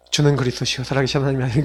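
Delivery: low, soft recited prayer; imitated murmured prayer. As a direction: act out praying quietly to oneself instead of yelling. Angry parent turning to prayer. (나지막이 기도하는 흉내)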